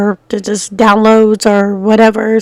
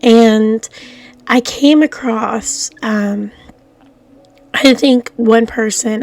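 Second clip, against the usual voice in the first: normal talking voice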